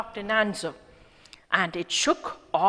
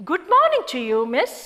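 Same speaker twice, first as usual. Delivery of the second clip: put-on high, loud old woman voice; old woman greeting; 8 semitones higher, 8 dB louder, faster than usual.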